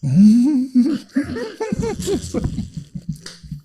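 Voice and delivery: high, long giggling fit; adorable giggle. Real, not acted. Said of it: "That's an adorable laugh".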